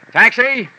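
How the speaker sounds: loud high hail across the street; hailing a taxi at full voice; calling out. Acted. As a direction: shout for a cab.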